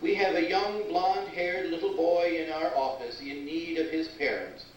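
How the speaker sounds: mall loudspeaker announcement; flat echoey pa page. Acted. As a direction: read it like a store PA.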